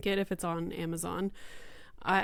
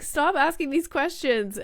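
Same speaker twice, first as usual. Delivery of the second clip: high-pitched, louder fed-up plea; exasperated parent; 7 semitones higher, 4 dB louder than usual. Retold or imitated. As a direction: plead in exasperation with a child. Exasperated parent answering child's questions.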